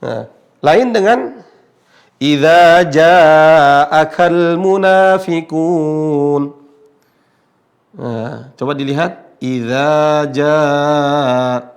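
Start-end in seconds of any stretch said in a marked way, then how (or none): 2.2-6.4 JA'AKAL chanted with a long, open "aa", never "o"; thin long alif. Shown as a demonstration. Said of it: "Intonasi panjangnya alif menjadi tipis". 9.4-11.6 JAA held very long on an open "a"; thin long alif. Shown as a demonstration. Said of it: "Intonasi panjangnya alif menjadi tipis".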